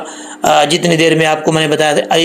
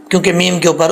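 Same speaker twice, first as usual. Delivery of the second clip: usual speaking voice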